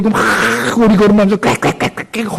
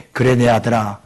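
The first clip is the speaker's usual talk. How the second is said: lower, softer fatherly reply; God answering; 8 semitones lower, 4 dB quieter than usual. Acted. God answering his suffering son, low tender reassurance. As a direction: answer gently like a loving father. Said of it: "하고 응답해 주시는 분이".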